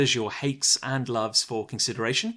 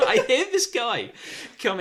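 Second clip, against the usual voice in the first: laughing; 12 semitones higher, 5 dB louder than usual. Real, not acted. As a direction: push through the giggles. Host laughing mid-story.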